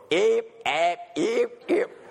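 high mock drill cadence with a lisp; lisping drill call. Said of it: "He had a lisp".

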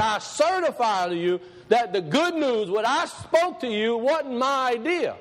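slow, high-pitched proclamation; scripture preaching. Acted. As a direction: declaim the apostle's words with drawn-out emphasis. Paraphrases and reads scripture in preaching voice.

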